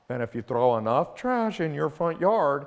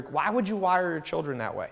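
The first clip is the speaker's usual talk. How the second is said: normal talking voice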